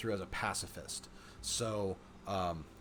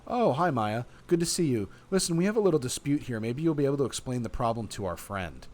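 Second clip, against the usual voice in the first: higher, louder, bright greeting; friendly Lisa; 4 semitones higher, 6 dB louder than usual. A narrator's reading. Lisa greeting Maya and asking her help, friendly. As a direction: lift the voice in an eager hello.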